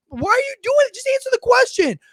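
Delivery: very high-pitched pleading outburst; incredulous, high-pitched cry; exasperated demand. Real, not acted. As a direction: demand it in high, fed-up frustration. Exasperated demand at evasive politician.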